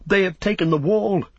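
high-pitched cry of alarm; men crying out the news. A narrator's reading. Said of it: "men cried".

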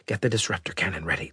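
fast unvoiced whisper. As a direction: whisper the order urgently. Secret order, whispered.

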